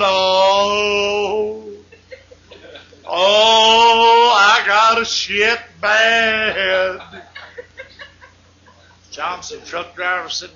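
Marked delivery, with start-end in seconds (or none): 0.0-7.0 drawn-out groan as he wakes; slow groaning patient. Acted. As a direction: moan it out slowly, half laughing. Patient groans after anesthetic.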